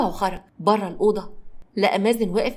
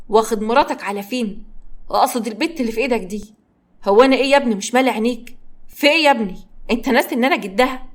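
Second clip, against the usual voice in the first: louder, exasperated scolding; annoyed grandfather; 2 semitones higher, 4 dB louder than usual. Acted. Jealous grandfather to the young man taking his granddaughter, louder and exasperated. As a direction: demand to know why they ignore him. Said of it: "ممدوح بغيظ".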